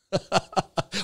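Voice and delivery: high burst of giggles; real laughter. Real, not acted. Host tickled by the viewer's wording, laughing. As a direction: crack up at the phrase. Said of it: "I'm, I'm laughing".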